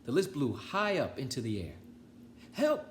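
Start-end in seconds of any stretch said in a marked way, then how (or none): 2.5-2.9 cry for help. Acted. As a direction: yelp it out in alarm. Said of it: "cried Toad".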